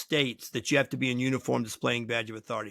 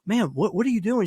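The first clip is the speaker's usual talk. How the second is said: higher-pitched, incredulous retold question; acted baffled protest; 7 semitones higher, 4 dB louder than usual. Lawyer calls the woman who complained, acted bafflement. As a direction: imitate a wronged lawyer demanding an explanation.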